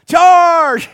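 sudden loud high battle cry; Wilma Flintstone charging. Retold or imitated. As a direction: bellow it like a cartoon wife charging into a sale. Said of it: "she's not like Wilma Flintstone".

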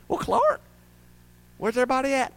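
lightly voiced wife, high on Clark; a puzzled wife's high question. Retelling the movie, a light high-pitched wife.

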